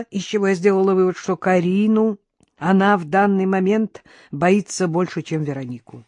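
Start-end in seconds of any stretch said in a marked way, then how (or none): none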